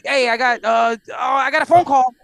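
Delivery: high, loud, stumbling pretend excuse; flustered excuse. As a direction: act out a hasty cover-up. Acts out excuse to leave and cry.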